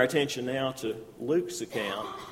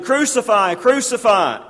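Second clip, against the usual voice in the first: higher, louder chant of crucify; crowd shouting; 8 semitones higher, 9 dB louder than usual. Acted. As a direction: shout it like the mob. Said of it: "they shouted".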